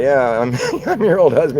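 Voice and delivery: lightly voiced kid, laughing through it; laughing mock quote. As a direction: toss it off, half laughing, as the boy. Reincarnated man tells widow.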